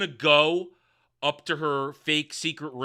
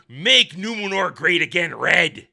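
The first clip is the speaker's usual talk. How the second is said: mock slogan; 7 dB louder than usual. Mocking show's slogan.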